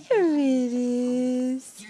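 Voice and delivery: very slow, drawn-out coo. Real, not acted. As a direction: stretch it out lovingly. Baby smiles, cooing.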